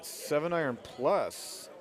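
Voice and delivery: live play-by-play, easy calls; golf commentator. Acted. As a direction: call the shot as it happens.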